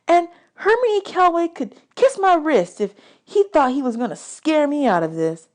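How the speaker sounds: ordinary delivery, nothing marked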